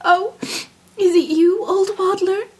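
put-on high-pitched, upset young princess voice; weepy princess, voice lifted high; tearful princess. A narrator's reading. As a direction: answer the frog through tears, pitched high. Princess answers frog while weeping.

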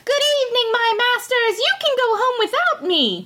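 high, small voice calling out with a laugh; tiny Tom Thumb laughing. A narrator's reading. Tom Thumb mocks the farmers from a mouse hole, high and teasing. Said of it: "cried he to them, laughing".

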